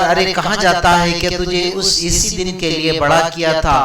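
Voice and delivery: high, loud anguished cry; alarmed shout of a pleading old mother; mother's cry. A narrator's reading. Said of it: "ماں چیخ کر".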